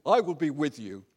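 loud booming God voice; booming voice from sky. Acted. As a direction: boom it down from heaven. God answers Moses in dialogue.

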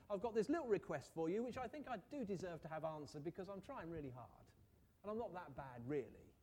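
hammed-up self-justifying plea to God; mock bargaining worshipper trying to earn a favor; bargaining prayer. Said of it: "Are our prayers sometimes a bit like that?".

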